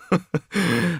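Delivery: high tipsy chuckle; a giddy drunk laugh; drunken laugh. A narrator's reading.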